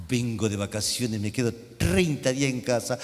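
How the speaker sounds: mother-in-law voice. Retold or imitated. Mother-in-law greeting her son-in-law, low mock voice. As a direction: play the unwelcome mother-in-law.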